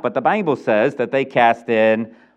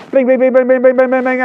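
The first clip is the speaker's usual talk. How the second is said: loud, high chanted bling bling; coin bling sounds; 11 semitones higher, 6 dB louder, faster than usual. Retold or imitated. Preacher imitates Count John's coins clattering, loud chant. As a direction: make the coins ring out.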